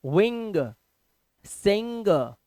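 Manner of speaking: "विंग" and "सिंग" drawn out on the NG; careful phonics reading of "विंग", "सिंग"; NG sound demo. Shown as a demonstration. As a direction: blend half N into G at the end of "सिंग". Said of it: "आधा ना और गा".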